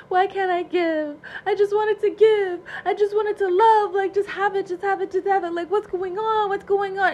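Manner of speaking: high, pleading, whiny Cancer voice. Retold or imitated. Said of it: "Cancer is, like, all the way like".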